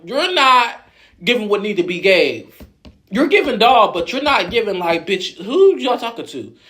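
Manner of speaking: hammed-up high-pitched dressing-down; mock bullying rant. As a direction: tear into her like the bullies did. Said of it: "they bullied the fuck out of".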